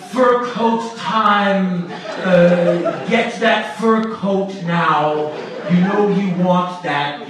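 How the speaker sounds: half-hearted hawker voice while laughing; mild hawker impression. Comic recalling the fur coat guy, laughing mock pitch.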